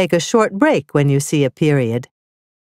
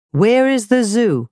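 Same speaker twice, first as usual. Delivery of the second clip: high, rising question tune; asking a question; 5 semitones higher than usual. Shown as a demonstration. Teacher showing a question to pupils, raised asking tune. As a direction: lift it clearly as a real question. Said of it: "The first sentence asks a question".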